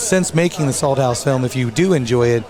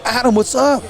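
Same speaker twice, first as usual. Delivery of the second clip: a clearly higher, friendly hello from a client; client greeting; 10 semitones higher than usual. Retold or imitated. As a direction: greet him like an old regular. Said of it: "they're like".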